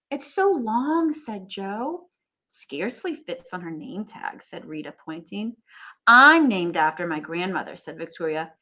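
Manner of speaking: read-aloud storybook voice; story narration. A narrator's reading. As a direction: keep reading the teasing scene to the class. Reading classmates' teasing.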